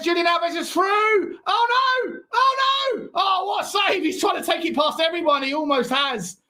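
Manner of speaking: high, urgent play-by-play peaking in alarmed shouts; excited live commentary. Real, not acted. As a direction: commentator yelling as the opponent breaks through. Live play-by-play of a dangerous attack.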